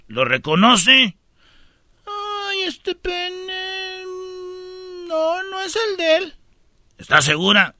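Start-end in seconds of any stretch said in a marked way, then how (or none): 2.1-6.3 high, soft tearful widow; sobbing widow. Retold or imitated. Widow identifying remains, high tearful whimper. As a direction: whimper it like a grieving wife.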